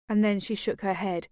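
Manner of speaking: ordinary delivery, nothing marked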